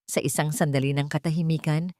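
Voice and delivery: plain reading voice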